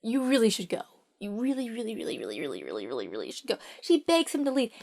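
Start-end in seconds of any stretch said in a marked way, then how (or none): 0.0-3.6 pleading, dropping lower and softer to near nothing; fading plea. Acted. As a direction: let each really sink quieter. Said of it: "she's like".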